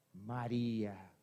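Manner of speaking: hushed, slow, reverent repetition of María; soft and slow. Acted. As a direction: let the name linger, hushed. Preacher repeats the name slowly.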